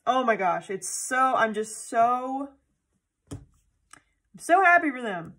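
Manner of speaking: slow, loud, overwhelmed with happiness; gushing joy. Real, not acted. About Christy and Zach's news, slow overwhelmed gushing. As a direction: let the joy spill out slowly. Emotional gush about couple's pregnancy.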